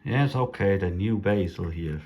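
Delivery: ordinary talk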